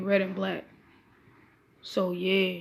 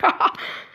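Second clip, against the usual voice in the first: short breathy scoffing laugh, no voice; mocking laugh. Tsunade laughing at Jiraiya's Hokage offer, breathy scoff. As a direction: laugh off the idea like it's a joke. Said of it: "Tsunade would start laughing".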